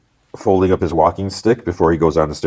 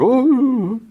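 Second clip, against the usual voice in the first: high, drawn-out "ooh"; frightened crowd gasp. Retold or imitated. Hostages see a rifle at Matt's chest, high alarmed ooh. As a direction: imitate onlookers' alarm. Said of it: "people are like".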